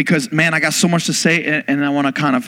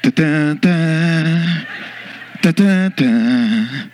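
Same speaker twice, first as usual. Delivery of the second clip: heroic fanfare hummed; much slower than usual. Retold or imitated. Sound as Matt appears.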